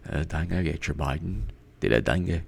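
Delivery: very soft, low try at Australian; tentative Australian accent; attempted Australian accent. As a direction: test an Australian accent under your breath. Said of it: "trying to read it with an Australian accent".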